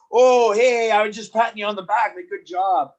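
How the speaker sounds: high, loud, fast sheepish cover-up; nervous innocent excuse. Retold or imitated. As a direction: talk your way out, nervously.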